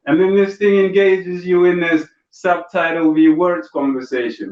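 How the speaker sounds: ordinary talk, nothing marked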